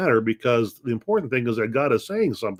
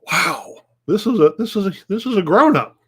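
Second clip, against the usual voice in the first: retold astonishment, higher pitched; amazed reaction; 7 semitones higher, 4 dB louder than usual. Pastor recounting a parking lot screaming match, amazed. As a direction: replay being taken aback, voice jumping up.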